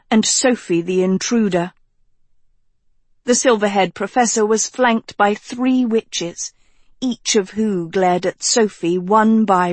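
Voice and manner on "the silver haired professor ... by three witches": ordinary delivery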